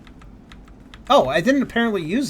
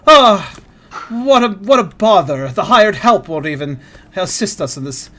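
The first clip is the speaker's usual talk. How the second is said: slow, louder snooty aristocrat; posh voice; 4 semitones higher, 7 dB louder, slower than usual. Retold or imitated. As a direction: drawl it like an offended noble. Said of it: "I love that posh voice".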